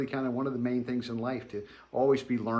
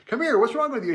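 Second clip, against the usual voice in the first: higher, louder worried dad; pitched-up urgent dad voice; alarmed father; 10 semitones higher, 5 dB louder, faster than usual. Retold or imitated. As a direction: call him over like a frightened parent.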